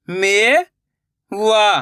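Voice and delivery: मेवा drawn out slowly, syllable by syllable; slow syllable split. Shown as a demonstration. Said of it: "मैं किस तरीके से".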